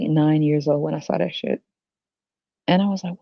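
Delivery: usual speaking voice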